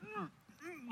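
high, soft closed-mouth humming; muffled mock pleading hums; mute man's mumbling. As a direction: strain to speak with lips sealed. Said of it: "the demon makes him mute".

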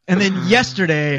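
normal talking voice

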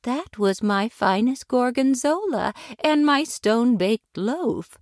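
put-on high, hurt old lady's protest; pleading in defense. A narrator's reading. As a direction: defend your cooking, wounded. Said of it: "she pleaded in her defense".

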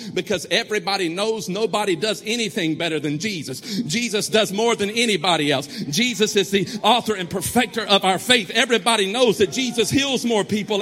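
loud, driving preacher cadence; preaching at full force. Real, not acted. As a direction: build the congregation up. Preaching climax.

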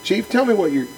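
a hint of a young officer losing patience; frustrated outburst. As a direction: demand an answer, fed up with the boss. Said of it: "I got frustrated with him".

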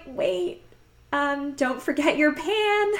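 high, slow, light mock reminder; mock sweet reminder. Reminding ex to take the pan, a high chirpy quote. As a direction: play her past self chirping it. Quoting herself to departing boyfriend.

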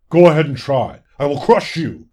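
loud, snarling lord; yelled threat. A narrator's reading. Fawn lord turns on the butterfly who challenged him, yelling. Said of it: "he yelled".

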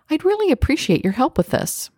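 high-pitched, polite ask for help; appreciative request. Acted. Someone asking a favor without apologizing, appreciative request. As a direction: lift the pitch in grateful asking.

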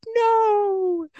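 put-on high, whining toddler "No"; a little child's protest, high and long; crying toddler's no. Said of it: "She was like".

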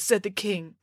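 ordinary delivery, nothing marked

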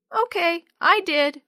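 put-on high, loud little-girl voice; little sister. A narrator's reading. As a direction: announce it like a small child. Little sister finishes thinking the vow.

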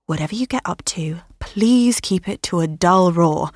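hushed whisper. Acted. Mum hushing the girls.

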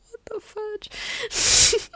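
high, drawn-out fangirl squeal; overwhelmed squeal. Retold or imitated. Fan recalling her reaction to a hot scene, overwhelmed squeal. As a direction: stretch it out high, as if overcome.

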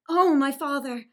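loud gasp of guilty alarm; dismayed exclamation. Acted. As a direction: catch yourself in alarm. Miranda realizes she disobeyed father.

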